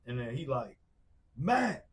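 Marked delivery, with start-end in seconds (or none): 1.4-1.9 high, loud mock yell; retold yelling. Friend screaming his name into the phone, high yell. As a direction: imitate a frantic caller crying out. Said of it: "he's yelling and screaming".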